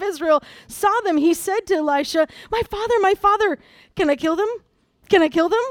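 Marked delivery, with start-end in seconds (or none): 2.5-5.6 put-on a touch of childlike over-eager begging, pitched up; lightly acted, excited asking for permission; eager pleading. King of Israel asks Elisha about captured enemies, mildly eager pleading.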